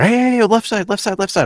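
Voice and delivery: high, loud coaching yell from the sidelines; manager yelling. Retold or imitated. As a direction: bark it like a manager on the touchline. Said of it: "yelling like a fucking manager".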